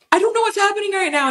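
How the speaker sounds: very high, overwhelmed retold wail; tearful bewilderment. Wife on the phone to husband after positive test, tearful bewilderment. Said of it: "now I'm bawling again".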